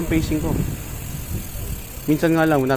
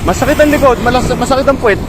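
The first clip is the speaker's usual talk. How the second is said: complaining; 6 semitones higher than usual. Retold or imitated. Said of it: "yung iba nagrereklamo".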